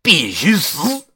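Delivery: high, drawn-out angry cry; furious one-line war cry; fuming. Acted. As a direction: spit out a slow, high-pitched threat. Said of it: "老范又气冲冲地说道".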